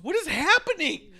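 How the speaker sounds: high, loud mock exclamation; exasperated outcry. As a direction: imitate a wrestler crying out in exasperation. Retelling a wrestler's exasperated outburst.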